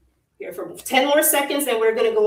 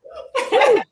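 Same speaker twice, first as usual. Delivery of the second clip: high laugh; 5 dB louder than usual. Real, not acted. Instructor laughing at a student's teasing, real giggles.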